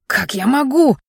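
startled protest; frightened. A narrator's reading. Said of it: "испугался парень".

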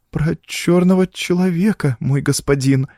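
put-on high, timid little-girl voice; frightened, halting. A narrator's reading. Girl answering the count, scared and halting. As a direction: falter it out timidly. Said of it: "запинаясь от испуга".

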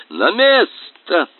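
slow, high command; yelling. Acted. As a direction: bark him back into place. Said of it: "орал матрос".

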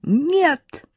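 high, thin scream of denial; girl's high-pitched protesting cry. A narrator's reading. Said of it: "тоже закричала Арина".

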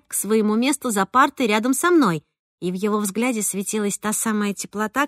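ordinary delivery

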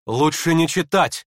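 firm, drawn-out urging; exclaiming. A narrator's reading. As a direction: exclaim it with sudden concern. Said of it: "воскликнул вдруг Евгений Павлович".

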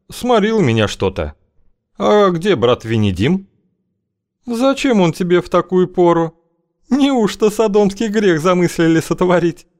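high, languid woman's voice; a sly, husky-toned crone's coo. Acted. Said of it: "томным голосом пропела она".

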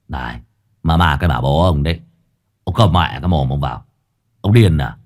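low, gruff telling-off; irritated scolding. A narrator's reading. Crewmate Duy tells off screaming Minh, irritated.

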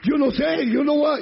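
high, loud protest; insistent shouting. Acted. Blind man defending his opportunity, insistent shout.

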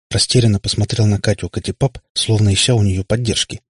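ordinary delivery, nothing marked

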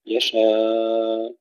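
يشاء with the alif drawn out moderately; medium lengthening. Shown as a demonstration. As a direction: stretch the alif of يشاء a little. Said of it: "والتوسط". (مد متوسط في يشاء)

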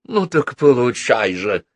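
menacing villainess, acted by a narrator; villainess's angry threat. A narrator's reading. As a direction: accuse in a high voice, then drop for the threat. Villainess attacks Natasha in the story.

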